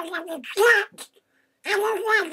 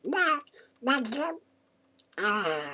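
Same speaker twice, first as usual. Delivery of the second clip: normal talking voice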